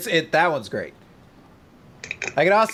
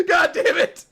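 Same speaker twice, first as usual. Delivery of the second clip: mock exasperated yell. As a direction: shout it in fake despair, laughing. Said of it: "I was like".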